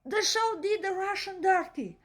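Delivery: ordinary talk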